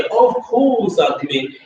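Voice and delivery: lightly mocking a cocky vow, slow and high. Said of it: "it sounds a little cocky though".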